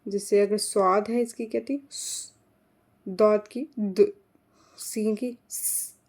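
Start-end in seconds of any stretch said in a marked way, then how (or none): 3.1-5.9 slow, द and स isolated as phonics sounds; letter sounds. Shown as a demonstration. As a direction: sound out द and स slowly. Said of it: "ये sounds थी, फोनिक्स थी".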